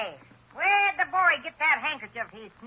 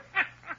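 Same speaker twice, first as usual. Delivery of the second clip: cackle; 4 semitones higher than usual. Acted. Crazy old woman laughing.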